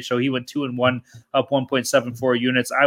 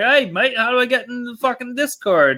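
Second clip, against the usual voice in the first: put-on Irish accent; 8 semitones higher than usual. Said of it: "like, Irish or something".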